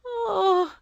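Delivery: a high, broken cry of grief; tearful cry. A narrator's reading. Two Eyes answering the wise woman, high and tearful. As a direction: cry out through tears. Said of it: "most bitter tears".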